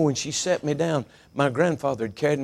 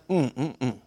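savoring hum. Retold or imitated. Paul savoring heaven in a vision, contented humming. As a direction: hum as if tasting something good.

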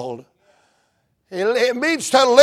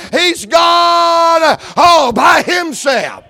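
louder and louder, driving every line home; preaching at full force; 2 semitones higher, 5 dB louder than usual. Acted. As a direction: preach it out, rising to a shout.

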